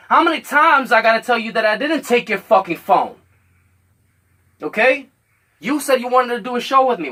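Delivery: loud, high angry shouting; angry yelling. Retold or imitated. Yelling at Richard over the missing phone, angry shout. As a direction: yell it at him, fed up and furious.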